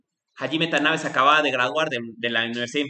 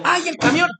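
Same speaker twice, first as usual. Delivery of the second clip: high, loud "¡Ay, el camión!"; alarmed cry; 13 semitones higher, 6 dB louder than usual. Retold or imitated. Teacher acting someone running for the departing truck, alarmed and high. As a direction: yelp as the truck leaves. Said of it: "Por eso cuando digo".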